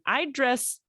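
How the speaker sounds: usual speaking voice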